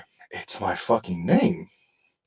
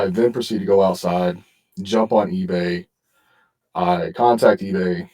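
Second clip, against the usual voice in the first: ordinary talk, nothing marked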